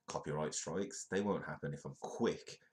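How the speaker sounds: ordinary talk, nothing marked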